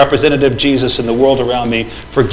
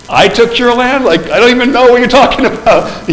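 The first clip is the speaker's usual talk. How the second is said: high, incredulous retort, pitched way up; mock indignant disbelief; 9 semitones higher than usual. Preacher playing the accused nation, incredulous and high. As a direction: act baffled at the accusation. Voicing the other side's retort.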